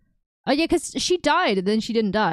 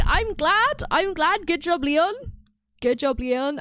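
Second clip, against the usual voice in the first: gleeful cheer; 7 semitones higher, 3 dB louder, slower than usual. Real, not acted. Cheering Leon after the story.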